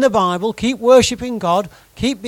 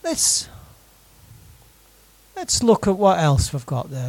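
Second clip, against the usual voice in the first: ordinary delivery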